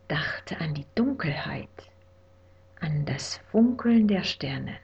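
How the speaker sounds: hushed, drowsy whisper; whispered bedtime story. A narrator's reading. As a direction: whisper them to sleep.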